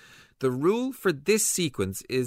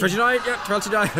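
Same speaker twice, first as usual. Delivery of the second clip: excited; 8 semitones higher, 7 dB louder, faster than usual. Acted. Excited confirming the house number.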